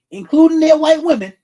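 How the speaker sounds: furious rise in the rant; angry outburst. Real, not acted. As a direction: hammer it home in anger.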